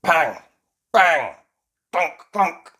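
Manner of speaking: loud, percussive spoken bangs; hammering sounds. A narrator's reading. Elves fixing the toy robot, loud banging noises. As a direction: voice each blow of the repair.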